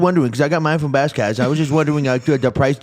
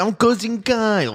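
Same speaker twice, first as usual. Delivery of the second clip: cousin Kyle voice; 9 semitones higher than usual. Pretend. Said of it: "Kyle's cousin".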